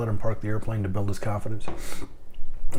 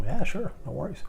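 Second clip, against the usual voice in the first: higher, breezy no-worries voice; easygoing reply; 8 semitones higher, 3 dB quieter than usual. Retold or imitated. Answering a clerk's thanks, high breezy reassurance. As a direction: brush it off lightly, sing-song.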